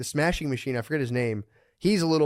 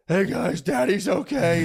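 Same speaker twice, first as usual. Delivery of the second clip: reassuring dad; 6 semitones higher, 3 dB louder, slower than usual. Retold or imitated. Fighter comforting crying daughters.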